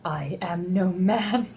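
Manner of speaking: slow, stretched whispered declaration; dramatic whisper. Acted. Joking comeback to the quote, slow dramatic whisper. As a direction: whisper it with slow, mock gravity.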